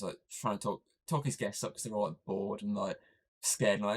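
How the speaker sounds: usual speaking voice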